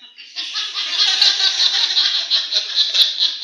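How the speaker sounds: laugh. Real, not acted. Laughing at own punchline.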